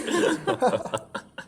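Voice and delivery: big rising laugh that falls away; hearty laugh. Acted. Old man laughing at friends' gossip joke. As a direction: let out a hearty belly laugh.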